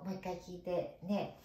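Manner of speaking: normal talking voice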